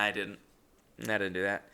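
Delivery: normal talking voice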